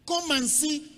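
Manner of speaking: high, loud call to the crowd; preaching call. Real, not acted. Preacher urging the congregation, high and loud. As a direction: summon them to look, loudly.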